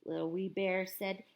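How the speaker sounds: usual speaking voice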